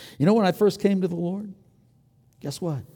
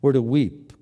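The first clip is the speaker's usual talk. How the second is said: usual speaking voice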